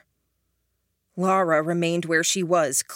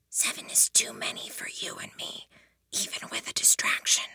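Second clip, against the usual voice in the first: whisper; 8 semitones lower, 8 dB quieter than usual. A narrator's reading. Helen translating in secret.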